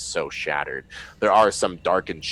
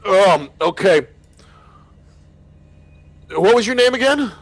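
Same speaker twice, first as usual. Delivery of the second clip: much higher, louder confused voice; dazed survivor; 14 semitones higher, 12 dB louder than usual. Acted. Groggy survivor asks the captain's name again. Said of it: "the head just sits back up again and goes".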